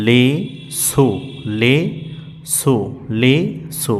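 spaced-out recitation drill; slow syllable drill. Shown as a demonstration. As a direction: separate syllables with pauses between. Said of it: "তাহলে কি হলো?".

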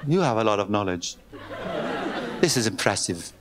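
put-on low, slow Scottish-accented praise; mock Scottish woman, impressed. Woman impressed by his knowledge, in a Scottish accent. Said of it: "למה עשיתי אותה סקוטית".

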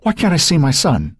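high, tense, pleading demand; strained, anxious mother; tightly wound. A narrator's reading. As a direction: press the question, voice pitched high and taut. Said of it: "her voice was as tight as an overwound clock spring".

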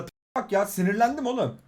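normal talking voice

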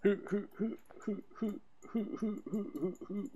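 high, playful hoo hoo calls; high hooting chant. Real, not acted. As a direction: hoot like an excited owl.

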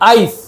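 "আইস" with a clear ai glide; careful Dutch pronunciation. Shown as a demonstration. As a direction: model "আইস" as one separate word, ai then s. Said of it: "উচ্চারণ দেখেন".

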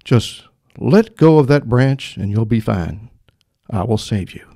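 slow, deep, weighty God voice. Acted. God telling the man to let go, slow and booming. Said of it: "said the voice".

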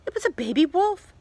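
usual speaking voice

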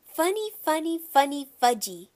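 loud, high chant falling at the end; small boy being silly; gleeful toddler. A narrator's reading. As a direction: chant it like a delighted little kid. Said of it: "Fudge laughed".

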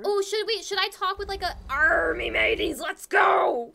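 loud mock pirate shout; mock pirate accent. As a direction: ham up a pirate rallying call. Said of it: "influenced by your accent".

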